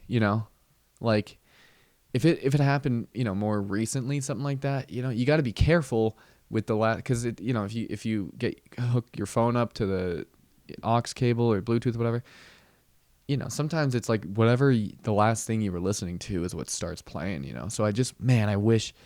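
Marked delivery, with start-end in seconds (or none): none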